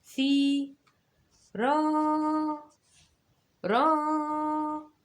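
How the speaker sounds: teacher modeling the vowel length; stretched syllables. Shown as a demonstration. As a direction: hold each long vowel in ثي and را. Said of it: "طولت".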